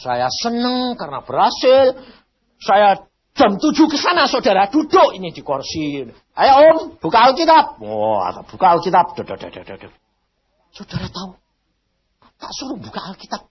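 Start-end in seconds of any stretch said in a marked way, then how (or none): 6.3-7.8 loud, high call to open the Bible; mock cheerful loud invitation. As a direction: urge him loudly to open it. Urging the rich man.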